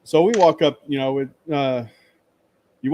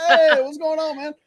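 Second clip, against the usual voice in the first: much higher, upbeat greeting back; excited reply; 14 semitones higher, 6 dB louder than usual. Retold or imitated. The YouTuber greeting him back, much higher and upbeat. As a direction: answer like a pleased buddy. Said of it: "He went".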